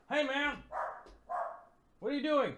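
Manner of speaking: high, soft pet talk; high voice to dogs. Real, not acted. Talking to barking dogs.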